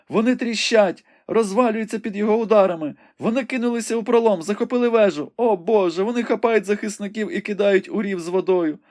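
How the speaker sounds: high, urgent maiden reporting a battle; young woman Rebecca, breathless and excited. A narrator's reading. Rebecca calls the battle out to the wounded Ivanhoe, high and urgent. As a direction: describe the fight in a high, eager voice.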